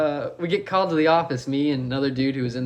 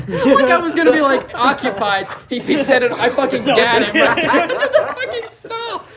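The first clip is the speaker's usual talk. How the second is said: high, loud, through laughter; laughing hard; 8 semitones higher, 6 dB louder than usual. Real, not acted. Friend riffing on an absurd gun scenario, laughing. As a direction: crack up while telling it.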